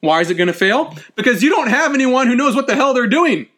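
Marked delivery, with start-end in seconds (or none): 1.1-3.5 higher-pitched exasperated outburst. Real, not acted. Lecturer explaining why dam projects fail, exasperated. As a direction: answer your own question in exasperation. Lecturer answering own question emphatically.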